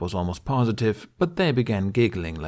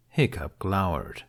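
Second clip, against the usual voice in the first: usual speaking voice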